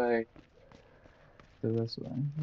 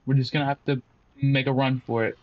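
ordinary talk, nothing marked

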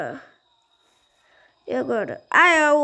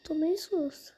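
scared whisper; 8 dB quieter than usual. Real, not acted. Scared again while playing.

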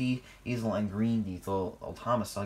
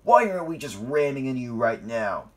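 loud, belligerent challenge; heated, aggressive demand; angry threat; 3 semitones higher, 8 dB louder than usual. A narrator's reading. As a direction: throw it out loudly, itching to fight. Engine threatens another in quarrel.

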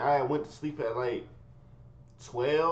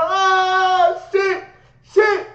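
much higher and louder, frantic yelling; pained yelling; 11 dB louder, much slower than usual. Retold or imitated. A boy being beaten with a shoe, pained yelling. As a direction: yell out in pain. Crying out when hit.

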